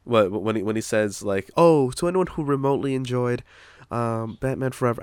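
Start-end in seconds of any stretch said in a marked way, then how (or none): 1.5-5.0 lightly voiced apologetic director, [name]-like; a hint of [name]'s speaking voice; Joel Schumacher. Director apologizing to fans, light [name]-like impression. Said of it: "I don't know why he sounds like [name]".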